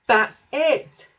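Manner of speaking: high, loud sudden realisation; excited cry. A narrator's reading. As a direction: cry out as it comes back. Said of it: "Percy cried".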